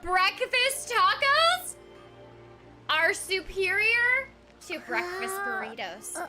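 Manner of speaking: normal talking voice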